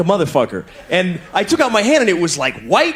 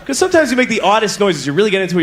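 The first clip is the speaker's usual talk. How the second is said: ordinary talk, nothing marked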